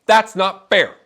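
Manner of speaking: put-on high, loud childish protest; whiny child. Daughter complaining to her father, whiny and loud. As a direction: wail it like a kid who lost out.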